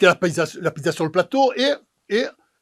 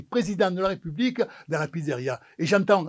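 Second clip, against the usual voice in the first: ordinary talk, nothing marked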